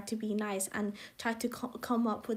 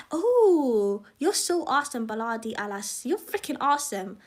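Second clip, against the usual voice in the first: excited acted praise; gushing excitement; 8 dB louder than usual. Imagined gushing reaction to someone.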